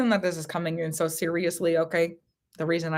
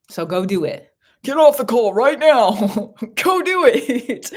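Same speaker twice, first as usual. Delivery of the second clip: loud, high laughing push to go; excited urging; 6 semitones higher, 7 dB louder than usual. Real, not acted. Psychic urging the caller to hang up and go, laughing loudly. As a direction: shoo them off the call, laughing.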